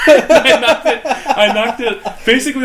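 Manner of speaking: high, laughing through the words; real laughter. Real, not acted. Barista retells knocking the drink back, laughing. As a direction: try to talk while cracking up. Cracking up at own story.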